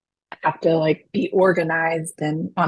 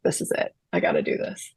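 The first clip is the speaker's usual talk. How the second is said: hushed whisper to herself; 8 dB quieter, faster than usual. Retold or imitated. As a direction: breathe it like a private vow. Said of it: "I was like".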